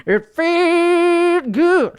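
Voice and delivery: high, slow put-on showman voice; trademark voice. Host welcoming viewers home in a high slow showman voice. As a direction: croon it like a lounge showman. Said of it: "stop stealing my trademark voice".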